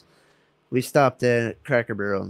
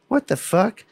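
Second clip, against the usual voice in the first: shocked outrage; 8 semitones higher than usual. Retold or imitated. Outraged at the Walmart call.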